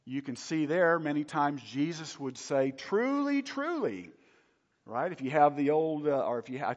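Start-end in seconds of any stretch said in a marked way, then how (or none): 2.7-4.1 "Truly, truly" pitched high, each word drawn out for weight; emphatic repetition. Retold or imitated. Preacher quoting Jesus calling for close attention, emphatic. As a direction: quote "Truly, truly" as a high, emphatic doubled call. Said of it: "you were making an emphatic point".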